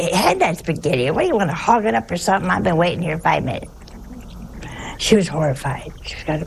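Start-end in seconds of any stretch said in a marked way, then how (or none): 0.0-3.7 fast, pushy table grab; rapid-fire demand. Retold or imitated. Brother at the Italian dinner demanding the spaghetti, fast and pushy. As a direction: rattle it off like an impatient sibling. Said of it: "the one over here would say".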